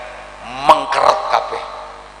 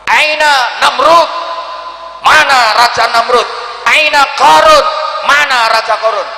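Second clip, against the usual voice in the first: high, loud, ringing shout; loud divine call; 11 semitones higher, 5 dB louder than usual. Acted. Preacher voicing God calling out Pharaoh loudly. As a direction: thunder the name as God calling. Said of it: "dengan suara keras itu".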